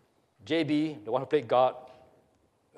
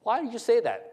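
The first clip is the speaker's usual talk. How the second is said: challenging question; 7 semitones higher than usual. Acted. God challenges Nichols's tone.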